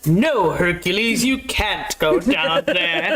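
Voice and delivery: slow, lightly voiced stern god; a hint of a stern father forbidding it; Zeus. Zeus forbidding Hercules to go down, slow and lightly stern. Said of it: "And he's like".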